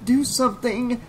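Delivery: high-pitched urgent yell; inner yell. A narrator's reading. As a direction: yell at yourself in desperation. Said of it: "Trish's mind was yelling at her".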